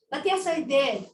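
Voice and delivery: ordinary talk, nothing marked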